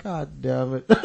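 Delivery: low, soft groaned curse with a chuckle; resigned exasperation. Retold or imitated. Inmate telling of dreading a fight, resigned exasperation. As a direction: quietly curse your rotten luck.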